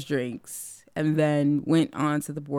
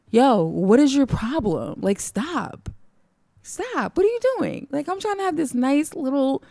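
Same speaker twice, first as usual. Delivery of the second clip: high, exasperated telling-off; hammed-up annoyed complaint, pitched up; mock irritation; 8 semitones higher, 4 dB louder than usual. Drinker to crying stranger on beach, mock exasperated.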